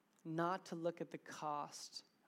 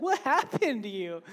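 loud, raised-pitch imitation of a stunned wife; shocked question; 5 semitones higher, 13 dB louder than usual. Wife finding dad crying in sauce, loud shock. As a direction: blurt it out in mock astonishment.

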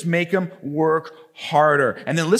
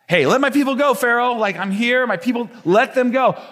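God dropping by like a buddy, higher pitched; casual God; 6 semitones higher than usual. Acted. Preacher voicing God telling Pharaoh to let his people go, casual and raised. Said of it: "That's what, like, God says".

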